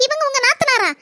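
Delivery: lightly voiced nurse consoling; hospital nurse. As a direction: soothe the weeping sister-in-law. Nurse comforting crying woman.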